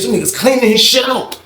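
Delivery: ordinary talk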